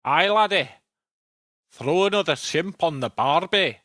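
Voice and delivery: a clumsy, lightly done Scottish voice sliding toward Australian; bad Scottish accent. Shown as a demonstration. Streamer asked about being a Scot in Australia, tries a weak Scottish accent. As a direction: attempt a Scottish brogue and miss. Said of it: "That's the best I can do".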